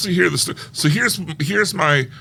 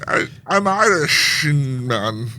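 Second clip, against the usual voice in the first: put-on an Irish accent he can't quite do; bad Irish accent; much slower than usual. Claiming to be Irish, in a bad Irish accent. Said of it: "I cannot do an Irish accent".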